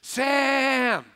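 high, loud call across the way; yelling a name. Retold or imitated. Wife yelling to the neighbor out the window, loud high call. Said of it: "she yells out".

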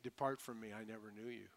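hushed, sinking to a soft close; soft, final dismissal from heaven's gate; solemn rejection. Acted. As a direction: turn him away quietly and gravely.

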